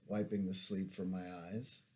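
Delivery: ordinary delivery, nothing marked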